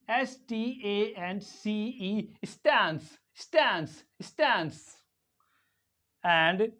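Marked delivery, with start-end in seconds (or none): 2.4-5.0 STANCE as 'staens', front 'ae' vowel; American A. Shown as a demonstration. Teacher drilling American English, 'ae' in STANCE. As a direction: say stance with the A of cat. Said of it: "in American English".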